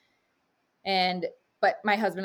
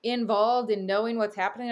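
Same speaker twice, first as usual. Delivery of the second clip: lightly voiced husband, higher and a bit louder; a hint of a worried husband questioning; husband gently doubting the plan; doubtful husband; 4 semitones higher, 3 dB louder than usual. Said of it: "my husband was like".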